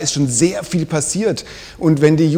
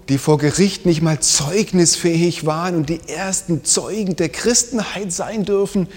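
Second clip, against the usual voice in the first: normal talking voice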